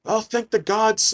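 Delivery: high, slow, overwhelmed relief; acted stammering joy at a son's return; tearful father. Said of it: "They start to cry".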